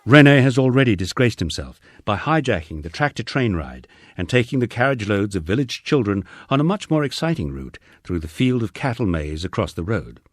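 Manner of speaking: plain reading voice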